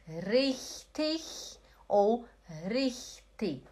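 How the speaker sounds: very slow richtig with ch, then with k; both endings contrasted. Shown as a demonstration. Teacher showing both are allowed, ch then k ending. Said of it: "Pode ser os dois".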